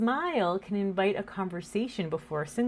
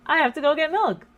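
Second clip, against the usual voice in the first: louder and higher, insistent; exasperated mom; 9 semitones higher, 6 dB louder than usual. Retold or imitated. Mom insists to daughter she must get milk, louder and higher. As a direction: mom brushing off the remark, a bit louder. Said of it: "And I'd be like".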